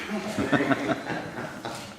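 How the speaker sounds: breathy laugh. Acted. Comic names get her own laugh.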